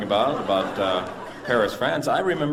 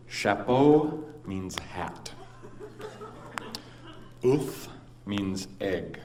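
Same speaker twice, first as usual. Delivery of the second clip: slow explaining; 3 semitones lower, 6 dB quieter, much slower than usual. Retold or imitated. Explaining French words slowly.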